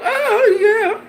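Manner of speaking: high mock gushing oh yeah. Mocking the media who give Canelo a pass, high gushing. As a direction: chirp it like a starry-eyed fan.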